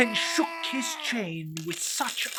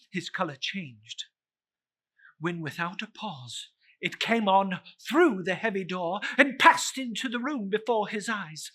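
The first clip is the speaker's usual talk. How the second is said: usual speaking voice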